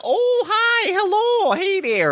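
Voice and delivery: very high cartoonish greeting voice; high put-on voice. Co-host greets the audience in a squeaky character voice. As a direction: squeak out a silly hello.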